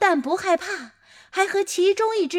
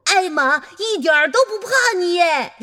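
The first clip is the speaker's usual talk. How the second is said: louder, higher and fast, 都 stressed, falling; cheering; 4 semitones higher, 7 dB louder, faster than usual. A narrator's reading. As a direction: cheer it out with delight. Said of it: "我欢呼道". (兴奋欢呼，重读都)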